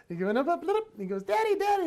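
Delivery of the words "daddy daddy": put-on high, loud little-kid call; excited small boy shouting over; child calling Daddy. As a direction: call out high for Dad's attention. Son calling from the slide.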